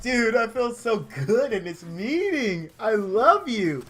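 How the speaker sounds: hammed-up drunken affection; mock boozy sincerity; tipsy whiskey drinker. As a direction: gush like a sloppy drunk, stretching the last words. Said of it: "They were sipping whiskey from mason jars".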